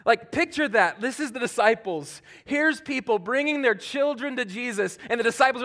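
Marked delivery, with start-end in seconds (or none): none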